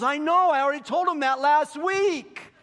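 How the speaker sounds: high, loud comic protest; exasperated yell. Acted. Peter butting in on God, exasperated yelling.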